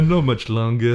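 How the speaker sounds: lightly mocked movie line; mild send-up of a poor actor; badly acted, close to your own voice; imitated bad acting. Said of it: "it's some of the worst acting".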